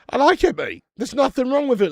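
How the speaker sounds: loud indignant defence of the beer. Real, not acted. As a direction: stand up for the beer loudly. Defending Heineken to chat.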